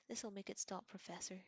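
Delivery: usual speaking voice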